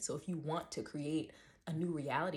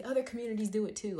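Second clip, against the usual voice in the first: higher and louder whiny retort; mocking commenter; 6 semitones higher, 5 dB louder, faster than usual. Mocking a commenter who deflects, in a whiny voice. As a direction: sneer it back like a defensive troll.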